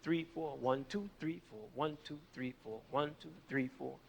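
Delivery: quiet, steady count with the music; whispered-low counting of the beat; soft counting along. Shown as a demonstration. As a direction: count the meter softly in time. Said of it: "let's count the meter of this".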